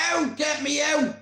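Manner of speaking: re-enacted high-pitched plea; panicked pleading. Victim begging the laughing onlookers, panicked pleading. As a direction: beg urgently to be released. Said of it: "I'm like".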